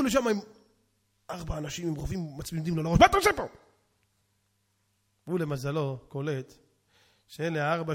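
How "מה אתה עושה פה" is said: loud, high guard's demand; armed guard yelling a question; shouted challenge. Acted. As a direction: shout it at an intruder.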